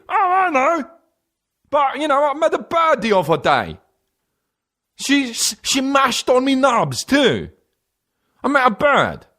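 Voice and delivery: very high, hammed-up British-style accent; fake British-style accent. New Jersey guy answering friends, absurd high fake British accent. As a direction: squawk it in a phony British accent. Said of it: "started talking like this".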